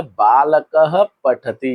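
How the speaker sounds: बालकः drawn out as "baa-la-ka-ha" before पठति; voiced visarga. Shown as a demonstration. Teacher reading बालकः पठति to a pupil, voiced visarga. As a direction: slowly sound the echoing "ha" ending of बालकः. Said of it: "इनको बेटा विसर्ग बोलते हैं".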